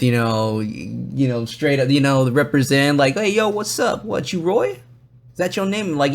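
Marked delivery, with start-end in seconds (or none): 3.1-5.9 high, casual imitation of a greeting; imitated street-casual hello; laid-back dance crew member. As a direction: size up the newcomer in a raised voice. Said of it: "they were kinda like".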